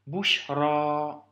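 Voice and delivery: bushra avec le a final allongé, lentement; bushra, alif maksoura en A long. Shown as a demonstration. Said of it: "se prononce comme un A long". (prononciation arabe lente)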